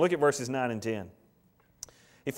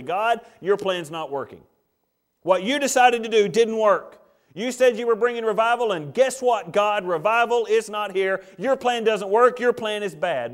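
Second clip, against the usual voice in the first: loud, higher, scolding God; accusing complaint; 5 semitones higher, 6 dB louder than usual. Acted. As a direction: hurl the blame at God. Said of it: "telling God very simply".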